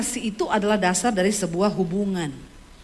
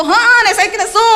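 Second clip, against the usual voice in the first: much higher, louder angry burst; now angry; 10 semitones higher, 9 dB louder than usual. Retold or imitated. Wife finally losing her temper at husband, angry shout. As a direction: snap back, now really cross. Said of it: "nadamu nesu toh".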